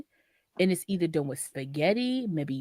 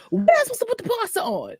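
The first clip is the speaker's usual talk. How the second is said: fast, higher panicked mom voice; flustered mom; 12 semitones higher, much faster than usual. Retold or imitated. Mom confused about the pasta water, fast and high. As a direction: rush it as a lost mother. Mom's confused question quoted.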